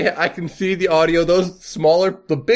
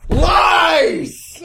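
high shrieking cry of "Lies!"; outraged shout; 5 dB louder than usual. Real, not acted. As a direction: scream it in outrage. Outraged at a Frozen pun.

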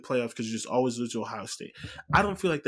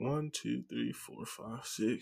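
muttered counting, soft; counting under breath; 11 dB quieter, much slower than usual. Real, not acted. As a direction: count quietly to yourself. Counting teams on a list.

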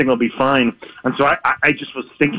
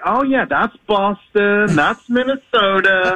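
put-on slow, higher, musing as if picking out accents; slow dawning recognition, pitched up; imitating a realization; 8 semitones higher, much slower than usual. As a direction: draw it out like it's just dawning on you. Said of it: "then you start to figure out".

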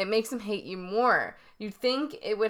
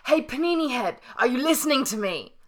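mock Gordon yell; 5 semitones higher, 4 dB louder than usual. Voices Gordon insulting a cook.

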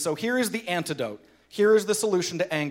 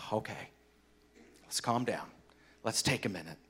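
much softer and lower, soothing; gentle calming; 6 semitones lower, 10 dB quieter than usual. Shown as a demonstration. Preacher showing how gentleness calms a fight, soft and soothing. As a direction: soothe a heated room quietly.

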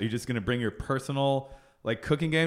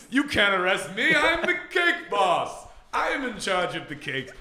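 slow, grand pompous proclamation; high haughty villain voice, drawn out; hammy stage actor; 5 semitones higher, slower than usual. Pretend. Cake boss arrest impression again.

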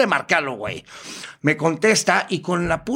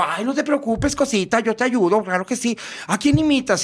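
high, campy reassurance; imitated transvestite performer; 6 semitones higher than usual. Transvestite performer offers help to the caller, high camp voice. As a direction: coo it in a put-on high voice. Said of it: "Y el otro".

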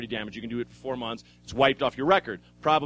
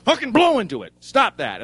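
louder, higher mock cop snapping; angry cop; 7 semitones higher, 7 dB louder than usual. Cop ordering the drunk to blow, mock angry. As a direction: bark the order like an annoyed cop.

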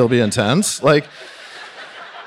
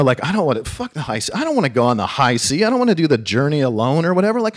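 ordinary talk, nothing marked

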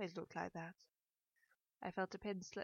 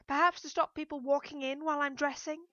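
raised, higher, sarcastic reply; irritated retort; 8 semitones higher, 11 dB louder than usual. A narrator's reading. Daughter retorts to mother through locked door, irritated. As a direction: call back with pointed annoyance.

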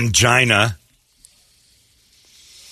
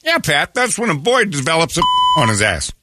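John Madden impression; 8 semitones higher than usual. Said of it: "John Madden".

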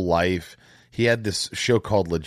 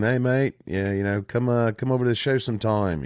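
mock Australian "hey mate" invitation; a lightly done Aussie twang, friendly; Australian accent; 4 semitones higher than usual. Host imitating a guest's tweet in Australian accent. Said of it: "is that a good Australian accent?".

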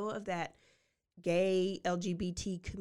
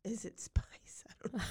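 whispered question; 4 dB quieter than usual. Real, not acted. Host muses on drugs to cohost, whispered and amused. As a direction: wonder aloud under your breath. Musing with a laugh.

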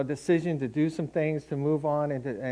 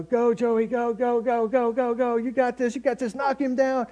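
higher, mock cheering yell for a son on the field; imitating a sideline scream; 8 semitones higher than usual. Mother screaming for her son at the football game, mock yell. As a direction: cheer him on like a mom at the game, hammed-up. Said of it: "she'd be running down the sideline screaming".

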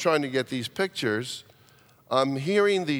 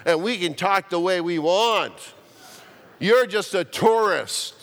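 put-on higher, louder mouthy kid voice; cheeky child talking back to a tourist, higher and louder; 5 semitones higher, 3 dB louder than usual. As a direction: sass him like a cheeky island kid. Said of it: "got extra mouthy and said".

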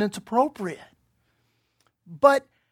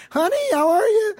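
high, sing-song mock mom voice; imitating mom; 11 semitones higher than usual. Preacher imitating his mom calling on his honeymoon, high and sing-song. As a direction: put on mom's sweet phone voice.

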